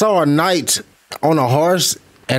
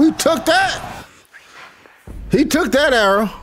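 amazed disbelief; 8 semitones higher than usual. Real, not acted. Amazed at arrow scene.

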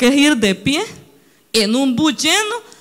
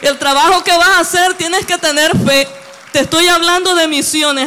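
fervent preaching; 4 semitones higher than usual. Acted. Preacher urging the congregation about faith, fervent. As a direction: preach it with conviction.

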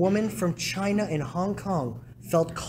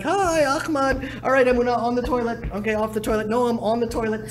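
a lightly voiced busy wife, higher; mild mock wife, higher; imitating wife; 5 semitones higher than usual. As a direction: juggle the kids in a hint of the wife's voice. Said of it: "be like".

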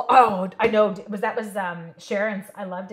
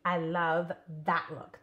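ordinary talk, nothing marked

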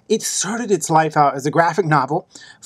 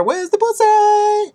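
very high, drawn-out squeal; mock eager hunchback; 3 dB louder, slower than usual. Hunchback taking up the Persian's offer, high slow squeal. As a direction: stretch it out in excited falsetto. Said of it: "and the dude's like".

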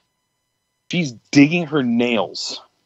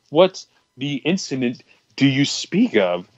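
slow, halting, stilted question; puzzled mock formality; 3 semitones higher than usual. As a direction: act a baffled official picking words carefully.

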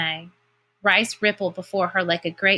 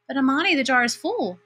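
higher-pitched mild protest; 6 semitones higher than usual. A narrator's reading. Girl tells stepmother the jar is full, protesting. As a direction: plead the case, pitched up. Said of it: "Pear Blossom protested".

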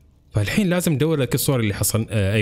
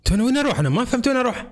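baffled; 12 semitones higher, faster than usual. Real, not acted. Lost in the game.

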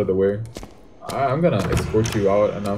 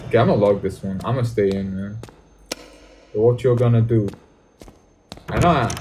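usual speaking voice

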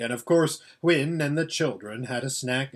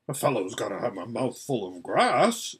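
gruff horse voice; 4 semitones lower than usual. Acted. Voices Bree.

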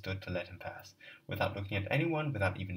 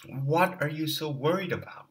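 agitated outburst; 7 semitones higher, 7 dB louder than usual. Acted. Character outburst in dialogue.

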